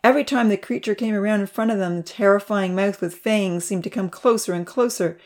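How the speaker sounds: plain reading voice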